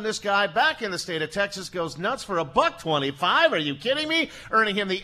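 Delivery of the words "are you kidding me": ordinary delivery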